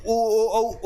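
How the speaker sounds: ओ, औ said with round lips; rounded vowel demo. Shown as a demonstration. Teacher proving lips must round, demonstrative vowels. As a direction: show that ओ needs rounded lips. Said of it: "वैसे अपन उ".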